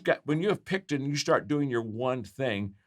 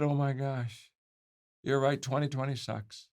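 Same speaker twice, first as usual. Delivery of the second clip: softer, measured retold concession; hushed realisation; 2 semitones higher, 5 dB quieter than usual. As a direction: concede it quietly, struck by the thought. Retold hushed realisation after reveal.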